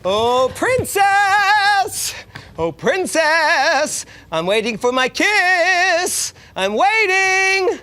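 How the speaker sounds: slow, drawn-out sing-song calls; calling frog. Acted. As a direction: call out lovingly, stretching every word.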